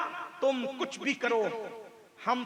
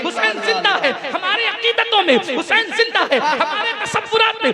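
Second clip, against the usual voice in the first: loud rhythmic refrain climbing higher to a peak; full-force preaching; 8 semitones higher, 13 dB louder than usual. Real, not acted. Preacher rousing the crowd that Hussain lives, climbing fervent shout. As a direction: build each repetition toward an impassioned cry.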